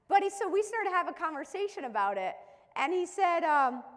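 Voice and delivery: usual speaking voice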